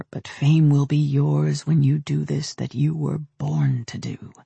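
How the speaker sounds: lightly voiced seductive male whisper; coaxing voice in her head; seductive inner voice. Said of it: "a seductive male voice whispered inside her skull".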